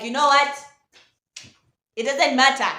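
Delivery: overly dramatic brush-off. Acted. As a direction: play it like a big dramatic dismissal. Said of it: "That was dramatic".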